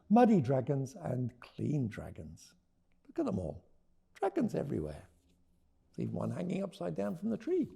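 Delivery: lively read-aloud voice; story narration. A narrator's reading. Author reading her book to listeners, lively. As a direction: read it to a roomful of children.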